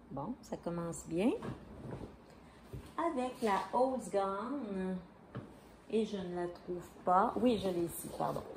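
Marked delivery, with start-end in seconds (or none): 3.0-5.0 slow, careful English yarn name in a thick French accent; self-mocking French accent on English words. Real, not acted. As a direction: sound it out the Quebec French way, haltingly. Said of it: "je vais parler anglais avec mon accent du diable".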